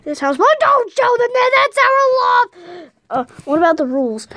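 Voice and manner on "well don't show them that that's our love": high-pitched, stretched-out objection; indignant protest. Acted. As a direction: protest in a raised voice. Objecting to what is being shown.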